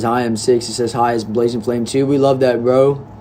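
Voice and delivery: normal talking voice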